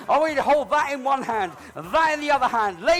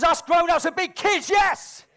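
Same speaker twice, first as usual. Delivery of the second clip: crowd-rousing shout; 7 semitones higher, 5 dB louder, slower than usual. Real, not acted. Entertainer geeing up the crowd.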